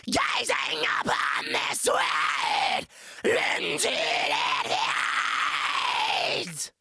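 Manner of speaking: low guttural distorted growl; deep rasping growl. Shown as a demonstration. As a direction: rumble it out like an earthquake. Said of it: "Here we go".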